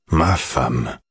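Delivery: put-on moved old man, low voice; voix émue. A narrator's reading. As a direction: say it low, with a touch of emotion. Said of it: "d'une voix émue". (voix de vieil homme, émue)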